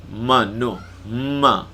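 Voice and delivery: Kelantan accent mano, stressed first m; mano in a Kelantan accent, lengthened m; Kelantan dialect. Shown as a demonstration. Teacher imitating Kelantan speakers for students, Kelantan accent. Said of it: "orang Kelantan sebut".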